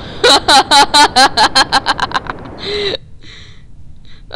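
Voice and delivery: long loud burst of laughing; loud laughter. Real, not acted. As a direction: laugh hard at the disaster. Laughing after crash.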